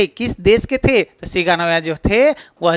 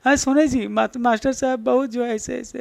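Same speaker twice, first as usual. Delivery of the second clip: higher, softer tearful wife, hammed up; mock sobbing; 4 semitones higher, 6 dB quieter than usual. Sobbing mother complaining to her husband about the schoolmaster, mock tearful. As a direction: imitate a sobbing wife faltering into faintness. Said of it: "सिसकते हुए बोलती हूँ".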